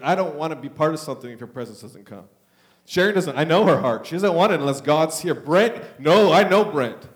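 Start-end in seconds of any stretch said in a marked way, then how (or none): none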